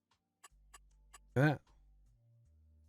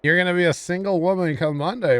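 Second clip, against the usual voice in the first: higher, louder teasing jab; cheeky joke; 6 semitones higher, 5 dB louder than usual. Real, not acted. Streamer teasing a viewer, higher and louder cheeky joke. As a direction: toss it off as a playful tease. Joking flirt line to viewer.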